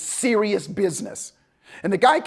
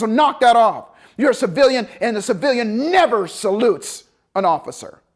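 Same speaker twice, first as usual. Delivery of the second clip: louder, a bit higher stern telling-off; stern scolding; 4 semitones higher, 5 dB louder than usual. Retold or imitated. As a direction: lecture him sharply. Instructor scolding civilian.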